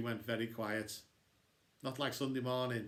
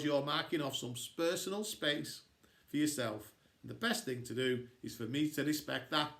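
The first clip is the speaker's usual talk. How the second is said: plain reading voice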